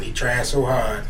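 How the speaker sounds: slow, spoken lyric line; drawn-out spoken line; slow quote. Retold or imitated. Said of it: "that Bone song".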